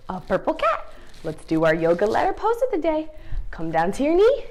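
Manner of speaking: ordinary delivery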